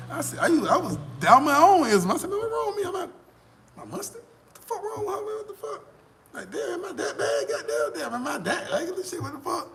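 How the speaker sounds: high, mock-sobbing whine of self-pity; hammed-up wail, am I that ugly; a hammed-up sob, complaining about the girl; mock crying. Retelling his own despair, mock-crying.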